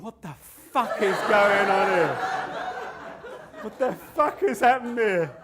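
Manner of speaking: high, loud mock outrage; shocked wife's outburst. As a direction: yell it as an appalled wife looking on. Acts out his shocked wife.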